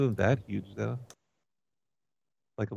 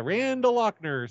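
high, drawn-out name call; mock holler to find someone in a room; demonstrated yell-out; 9 semitones higher, slower than usual. Shown as a demonstration. Caller shows worker how to find Randall, demonstrated yell-out. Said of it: "Just, just yell out".

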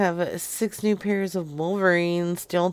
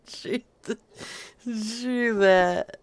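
normal talking voice

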